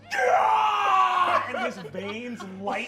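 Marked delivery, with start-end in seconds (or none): 0.1-1.4 long, high, loud yell; triumphant roar. Acted. Giant grabs the sun and roars. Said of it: "he goes".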